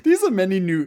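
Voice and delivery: hammed-up thrilled outburst; excited exclaim. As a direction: burst out with it. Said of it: "I was like".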